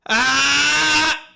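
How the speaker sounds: high, loud yelp of pain; a real yelp as the tangled prop comes loose; pained cry. Real, not acted. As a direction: cry out sharply as it rips free. Pain as the hair pulls free.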